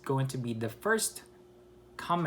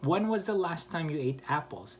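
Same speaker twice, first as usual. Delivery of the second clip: higher start, dropping on "apples"; falling question intonation; 4 semitones higher than usual. Shown as a demonstration. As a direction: let "apples" fall at the end. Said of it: "it actually goes down".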